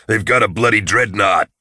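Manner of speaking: alarmed soldier's exclamation; exclaiming. A narrator's reading. Nail warns his comrades of the dreadnought, exclaiming. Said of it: "Nail exclaimed".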